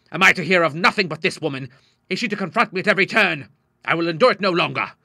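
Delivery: an angry father ranting; frenzied anger. A narrator's reading. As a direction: vent his exasperation. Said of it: "he cried, striking frenziedly".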